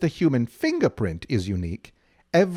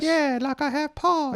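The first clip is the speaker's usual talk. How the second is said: kitten voice; 13 semitones higher than usual. Pretend. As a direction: squeak it out like a tiny kitten. Kitten explains its name.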